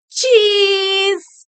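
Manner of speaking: high, long-held happy cheese; cheery camera cheese. A narrator's reading. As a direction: draw out the word high and sweet. Betty's surprise answer for camera.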